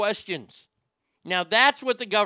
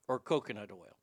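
ordinary talk, nothing marked

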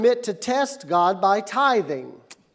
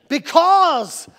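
slow, loud wrestler's declaration; big, high, stretched-out boast; Ric Flair; 5 semitones higher, 6 dB louder, much slower than usual. Retold or imitated. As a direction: announce yourself like a wrestling star, drawn out and higher. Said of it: "How many remember Ric Flair?".

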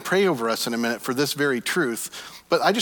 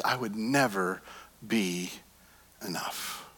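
drops softer word by word, ending whispered; fading to a whisper; 5 semitones lower, 8 dB quieter, slower than usual. Pretend. Insecure believer's inner voice, fading into a whisper. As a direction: let "enough" die to a breath.

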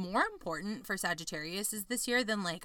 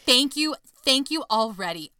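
excited thanks; 5 semitones higher, 9 dB louder than usual. Real, not acted. Thanking for the good Capricorn reading.

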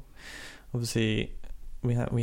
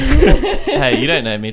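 loud, laughing mock defiance; mock indignant retort; 4 semitones higher, 9 dB louder, much slower than usual. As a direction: fire back in playful indignation. Jokey defiant comeback.

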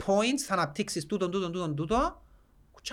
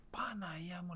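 retold gasp of wonder, whispered; awed whisper; 4 semitones lower, 22 dB quieter than usual. Amazed at what the chatbot produced, whispered. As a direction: breathe it out in amazement. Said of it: "λαλώ, ρε, φίλε".

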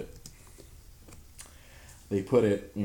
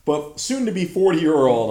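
ordinary talk, nothing marked

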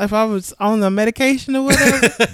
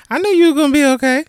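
fast, high-pitched brush-off; imitating mother's brusque dismissal; 6 semitones higher, 4 dB louder, faster than usual. Mother brushing off surgery news, quick and dismissive. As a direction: rattle it off, pitched up, unworried. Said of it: "That's how she said it".

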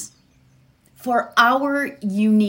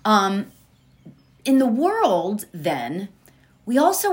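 usual speaking voice

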